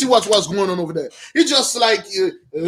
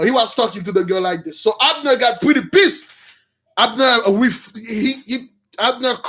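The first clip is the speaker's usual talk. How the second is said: ordinary talk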